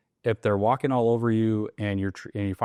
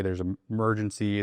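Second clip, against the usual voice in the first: usual speaking voice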